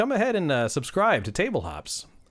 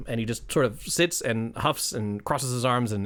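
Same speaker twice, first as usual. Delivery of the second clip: ordinary talk, nothing marked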